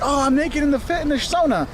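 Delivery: high, lightly voiced shocked tourist; mock tourist exclamation. Host mocking travel videos with a lightly voiced excited tourist. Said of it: "travel videos will get things like".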